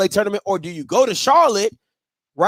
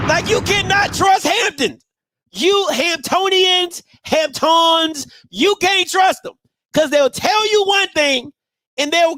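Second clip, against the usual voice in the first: shouting; 7 semitones higher, slower than usual. Real, not acted. Ranting about Hampton.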